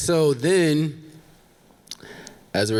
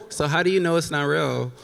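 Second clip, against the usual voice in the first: ordinary delivery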